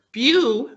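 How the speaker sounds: "Beau" long and emphasized. Shown as a demonstration. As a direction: punch and hold the syllable "Beau". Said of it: "say the syllable louder".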